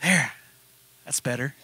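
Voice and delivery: low, soft settling-down; quiet relief. Acted. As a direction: ease off softly, as if cooling down. Teacup cooling off after the oven.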